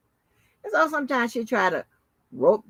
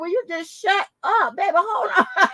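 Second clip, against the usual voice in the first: laughing; 9 semitones higher than usual. Retold or imitated. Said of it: "the girl ... told me".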